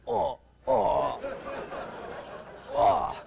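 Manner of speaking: low strangled panicked groans; reptilian groans. Retold or imitated. Terrified co-pilot on takeoff, low strangled groans. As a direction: groan like the brain switched off. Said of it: "He's making those reptilian sounds".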